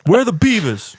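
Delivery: high squeaky joking Bigfoot; mock Bigfoot. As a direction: squeak it out like a goofy monster.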